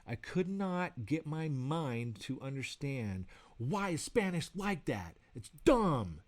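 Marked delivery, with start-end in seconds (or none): 3.6-6.2 retold exasperated outburst, pitched up, landing loud. Learner fed up with Spanish verbs, exasperated. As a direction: protest in frustration, higher, punching the last word. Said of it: "it was driving me insane".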